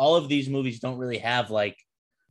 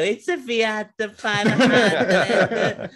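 slow hammed-up Italian accent, much higher and louder; mock Italian accent laughing about Jared Leto; 10 semitones higher, 6 dB louder, much slower than usual. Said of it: "Jared Leto".

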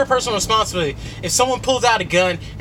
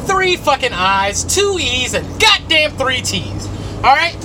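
spelled-out letters punched higher and louder; emphatic; 6 semitones higher, 3 dB louder, slower than usual. Real, not acted. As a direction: hammer each letter count home. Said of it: "I fucking curse".

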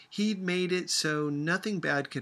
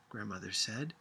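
usual speaking voice

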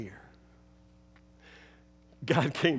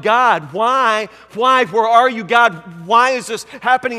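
loud anguished questioning cry; 5 dB louder than usual. Retold or imitated. Jesus crying out asking God why, loud. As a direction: plead up to God, pressing. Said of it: "is crying out".

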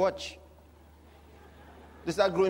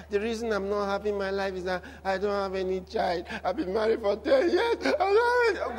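mock crying. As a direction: start complaining, build into a fake sob. Acts out childless woman's tearful complaint.

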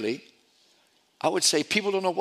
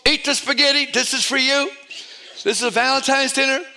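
calling out; 6 semitones higher, 3 dB louder than usual. Retold or imitated. Serving guests at dinner.

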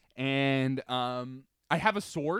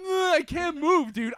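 high, loud, slow strained plea; panicked strain; 12 semitones higher, 6 dB louder, slower than usual. Retold or imitated. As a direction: force the words out through panic. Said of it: "I'm like".

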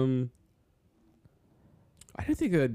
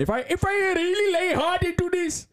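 very high Indian-accented voice; put-on Indian accent. Shown as a demonstration. Showing off to a friend, exaggerated Indian accent. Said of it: "like, an Indian accent".

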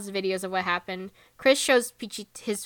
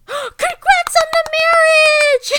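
much higher, louder, slow gushing cheer; high, drawn-out squeal of congratulations; hype cheer; 10 dB louder, much slower than usual. Retold or imitated. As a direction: gush it like an overexcited hype man. Pichit's hype cheer retold.

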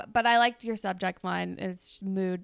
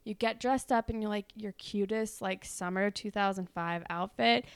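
usual speaking voice

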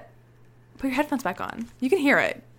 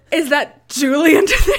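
loud, high, laughing mock Susan; mock-shocked Susan; 5 semitones higher, 13 dB louder than usual. Host acting out Susan catching her, loud and laughing. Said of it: "and Susan goes".

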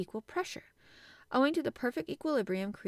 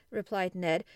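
ordinary delivery

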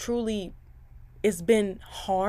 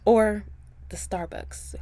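playful whisper; slower than usual. Pretend. Host whispering to listeners to get the popcorn ready, playful whisper. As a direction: lean in and whisper it like a secret.

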